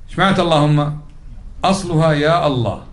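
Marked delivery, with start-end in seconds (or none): none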